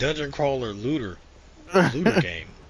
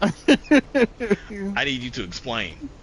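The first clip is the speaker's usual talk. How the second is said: high, slow, loud demand; mock exasperated soldier; 6 semitones higher, 4 dB louder, much slower than usual. Soldier demands an explanation from his bosses, exasperated. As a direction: drag it out in disbelief. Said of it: "I don't".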